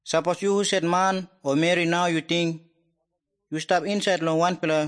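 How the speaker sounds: ordinary talk, nothing marked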